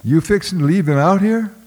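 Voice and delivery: put-on boy calling after the overseer, shrill and scared. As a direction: call out after him, voice cracking high. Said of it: "my voice gone shrill".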